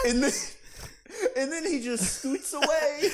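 talking through laughter; can't stop laughing while telling it; laughing while retelling. Real, not acted.

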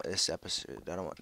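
ordinary delivery

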